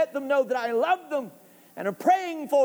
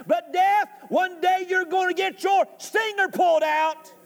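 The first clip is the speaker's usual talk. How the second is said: high, impassioned preaching accusation; taunting Death; 6 semitones higher than usual. Acted. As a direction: confront Death with raised voice, building to a threat. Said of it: "He's taunting".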